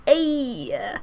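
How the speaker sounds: a soft put-on "Ay"; mock shame at a friend's pun; pun groan "ay". Groaning at a friend's pun, soft "Ay". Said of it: "I go".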